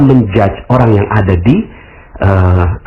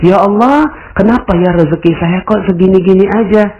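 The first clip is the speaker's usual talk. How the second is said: pitched-up whiny plea to God; complaining prayer; 7 semitones higher than usual. Pretend. Listener imitating someone praying about their meager income, whiny. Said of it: "seandainya Anda sekarang ini berintrospeksi".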